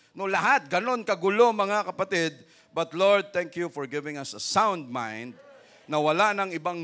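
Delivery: loud, high praise shout to the Lord; full-force preaching. Acted. Preacher to the congregation, loud high praise shout. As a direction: lift the whole church into praise.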